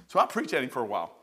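ordinary talk

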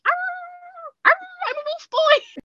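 put-on very high growling little wolf boy; toddler wolf. Imitating the two-year-old nephew during the announcement, high growl. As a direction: play a tiny child growling like a wolf. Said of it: "decided ... going to start being a wolf".

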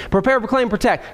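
quick run-through of the list; brisk recap, no gaps between items; moving along briskly. Real, not acted. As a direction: rattle the three words off without pauses. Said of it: "I could keep moving".

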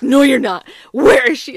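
loud, disbelieving retort; freaking out. Retold or imitated. Wife rejects the "just kidding" excuse, loud mock freak-out. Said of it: "freaking out".

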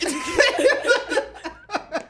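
loud high-pitched cackle; high shrieking laugh. Real, not acted. Laughs at own dark joke.

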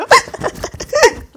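shrieking laughter; high cackle; loud high laugh. Real, not acted. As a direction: burst out laughing. Laughing at own story.

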